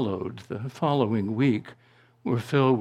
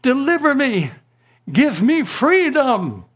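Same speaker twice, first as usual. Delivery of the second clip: pleading cry; 11 semitones higher, 5 dB louder than usual. Retold or imitated. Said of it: "literally it's translated".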